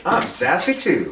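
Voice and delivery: high, mock-sassy girl; slow it down and pitch up, faking sass; put-on sassy. Nice girl copying her sassy friends, put-on sassy. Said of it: "sassy, suddenly she's like".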